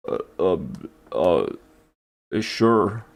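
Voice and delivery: mock deep hesitant reply; low voice. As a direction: answer in a comically deep voice. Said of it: "why is your voice so low?".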